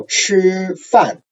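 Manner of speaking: 吃 held high, 饭 suddenly falling; slow demo of high 吃 into falling 饭; first tone plus fourth tone. Shown as a demonstration. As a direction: stretch 吃 high and level, then drop 饭 sharply. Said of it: "there's a suddenly down".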